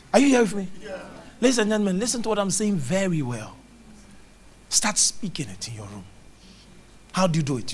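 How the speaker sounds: ordinary talk, nothing marked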